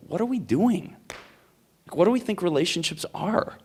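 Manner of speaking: high-pitched incredulous challenge; exasperated questioning. Acted. Acting out exasperated reaction to disapprovers.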